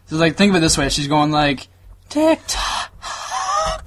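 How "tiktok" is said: mock out-of-breath gasping between words; high, airy word with a big labored breath; heavy breathing. Mocking how she can't talk without breathing, winded. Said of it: "breathing and shit".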